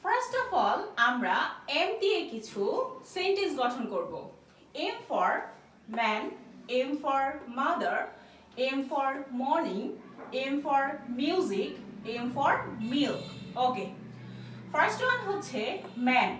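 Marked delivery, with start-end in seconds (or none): 4.9-13.2 very slow, spaced word list; slow, deliberate teacher recitation; slow drill. Shown as a demonstration. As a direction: drill each word slowly for the class.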